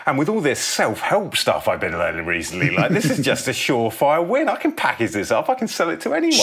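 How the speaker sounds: high, eager rush of a sudden idea; excited epiphany. Acted. [name] in the cinema, excitedly pitching to himself. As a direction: pump yourself up, the idea is landing.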